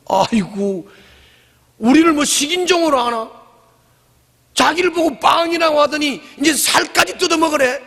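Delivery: high, mocking disbelief; outraged crowd. Scandalized crowd mocking Jesus's words, high and outraged. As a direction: ridicule the idea of eating his flesh. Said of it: "막 그러면서 막 수근거리고 난리 났어요".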